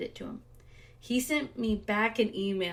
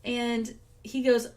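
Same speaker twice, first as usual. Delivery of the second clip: ordinary talk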